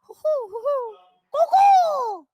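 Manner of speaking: high, loud owl hooting. Pretend. Answering bird calls, loud high owl hoots. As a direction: hoot loud enough to echo. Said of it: "It's, like, echoing so loud".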